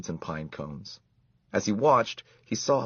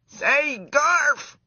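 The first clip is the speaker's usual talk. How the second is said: very high, loud squeaky shout; top of his voice; 7 dB louder than usual. A narrator's reading. Desperate mouse shouting at the boy, full-voiced squeak. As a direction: yell with everything a mouse has. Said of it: "at the top of his mouse voice".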